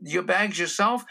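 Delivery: insistent questioner. Acted. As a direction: lightly play a pushy security questioner. Acted insistent airport questioner.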